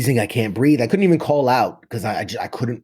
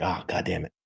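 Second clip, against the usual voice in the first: a much softer, under-the-breath curse; muttered curse; 4 semitones lower, 10 dB quieter than usual. Retold or imitated. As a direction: drop almost to nothing on the curse. Told there's blood everywhere.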